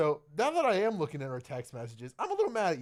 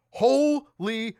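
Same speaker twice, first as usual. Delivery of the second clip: loud, high, drawn-out holy; hammed-up shout of awe; mock-amazed exclamation; 7 semitones higher, 7 dB louder than usual. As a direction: belt it out as someone's stunned text. Acts out friend's abrupt excited text.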